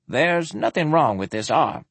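mildly accusing doctor. Acted. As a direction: point out the deception. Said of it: "he accused".

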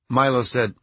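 ordinary delivery, nothing marked

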